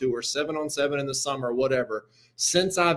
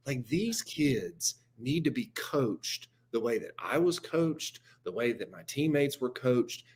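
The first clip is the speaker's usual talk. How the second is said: slow, soft impression of a coach explaining himself; hushed, measured retold justification; unbothered coach; 3 semitones lower, 8 dB quieter than usual. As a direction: lower the volume and spell it out deliberately. Coach's calm self-justification, acted out.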